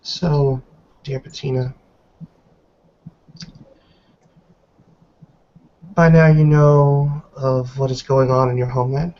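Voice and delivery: put-on measured, grave reproach from an old woman; lightly voiced stern elder. Elder matriarch scolding Miss Stewart in her cell, stern and disappointed.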